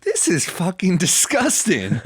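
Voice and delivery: high, slow, drawn-out disgust; mock a scandalized audience member, stretching the words; hammed-up indignant outburst; imitated outraged heckler.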